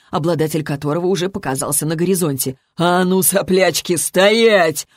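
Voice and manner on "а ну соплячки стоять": slow, rough command; hoarse smoker's yell. A narrator's reading. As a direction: order them to stop in a smoky rasp. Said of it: "вопил прокуренный сиплый голос". (сиплый пьяный окрик)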